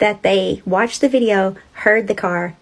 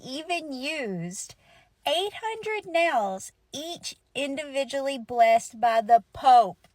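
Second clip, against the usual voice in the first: drawn out and higher; slow dramatic reveal; 3 semitones higher, 7 dB quieter, slower than usual. Acted. Storyteller building up the nails, slow and high. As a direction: stretch each word for effect.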